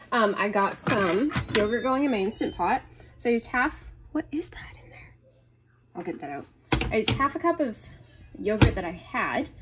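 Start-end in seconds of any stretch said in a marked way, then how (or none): 4.1-6.5 hushed, whispery aside; puzzled whisper under the breath; muttering to herself. Real, not acted. Muttering to herself at spotting something.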